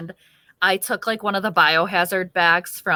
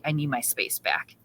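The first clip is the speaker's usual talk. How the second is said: hushed plea; 5 semitones lower, 10 dB quieter than usual. Retold or imitated. Mom's aside to Papa, whispered.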